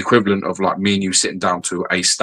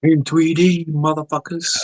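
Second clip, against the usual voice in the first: mock movie trailer; 8 semitones higher, slower than usual. Hyped trailer-style joke.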